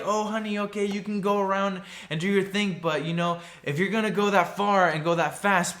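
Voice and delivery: high-pitched doting parent voice; lightly acted parent humoring an excited child; indulgent parent. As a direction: play a parent cautiously giving permission. Voices parents indulging speedster twin.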